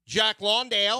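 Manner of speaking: hammed-up Australian, Jack Lawndale; mock Australian accent. Shown as a demonstration. Host teasing co-host in a mock Australian accent. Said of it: "that's how you pronounce it".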